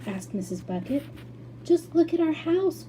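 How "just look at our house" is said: dismayed cry. A narrator's reading. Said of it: "cried poor Mister Bucket".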